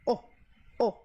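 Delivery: O said closed, lips rounded, like Spanish "o"; a tight, closed "O", never opening. Shown as a demonstration. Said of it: "É o que eu chamo de O fechado". (O fechado, bem fechado)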